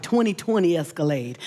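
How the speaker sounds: normal talking voice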